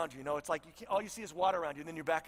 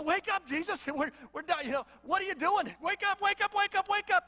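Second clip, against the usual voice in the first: rising high, loud frantic urging; panicked pleading; 11 semitones higher, 4 dB louder than usual. Acted. Scared disciples yelling at sleeping Jesus, frantic and high. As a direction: shake him awake in a panic, pitch climbing. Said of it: "sounds a little like".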